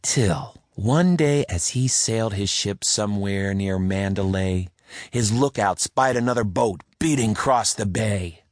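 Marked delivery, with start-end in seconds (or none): none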